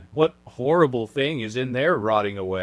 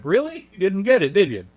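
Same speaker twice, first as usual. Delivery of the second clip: mocking; 7 semitones higher, 4 dB louder than usual. Mocking the old animators.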